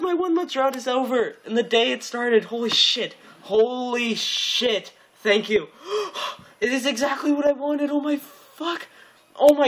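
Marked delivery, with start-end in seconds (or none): none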